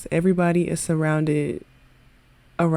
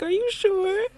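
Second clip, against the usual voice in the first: tiny, very high questioning voice; mock-timid high plea; insecure girl; 14 semitones higher, 3 dB quieter than usual. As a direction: ask it in a squeaky, doubtful girl's voice. Insecure girl asking boy, voiced.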